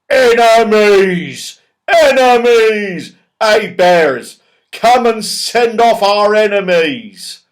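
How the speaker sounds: loud, slow, high howling cry; windy snowy shout. A narrator's reading. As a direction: howl it like a winter wind. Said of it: "shout loudly in a windy, snowy voice".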